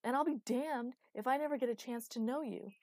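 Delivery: high, loud, desperate declaration; roared. A narrator's reading. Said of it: "roared Phaestus".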